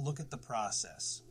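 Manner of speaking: usual speaking voice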